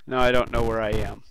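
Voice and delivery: usual speaking voice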